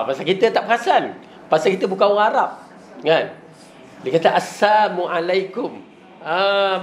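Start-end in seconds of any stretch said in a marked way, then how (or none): 4.4-5.8 "Assalamualaikum" said slowly as "samualaikum" with no L; as-samu greeting. Shown as a demonstration. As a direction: sound the "Assalamualaikum" as "as-samu alaikum", dropping the lam. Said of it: "bukan assalam".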